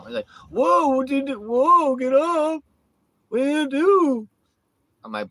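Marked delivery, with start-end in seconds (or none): 0.5-4.3 high, loud squeaky taunting voice; mocking falsetto taunt. Mocking the rival he threw off the RV, squeaky high taunt. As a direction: mimic a whiny tough guy squealing.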